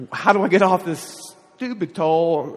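stuck on the toll, the lost driver cries out in panic; panicked outcry. Retold or imitated. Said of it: "panic, right?".

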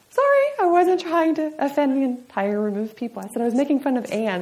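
high-pitched mock apology with a Texan slant; high, exaggerated Texan "sorry"; retold Texan apology. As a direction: apologize in a playful put-on Texan voice. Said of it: "it came out totally Texan".

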